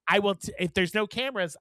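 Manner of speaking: ordinary delivery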